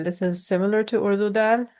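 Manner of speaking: ordinary talk, nothing marked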